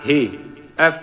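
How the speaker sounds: each syllable said alone with long gaps. Shown as a demonstration. Teacher to students, spelling out the verse syllable by syllable. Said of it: "আবার শুরুতে আসুন ভাঙা ভাঙা উচ্চারণ".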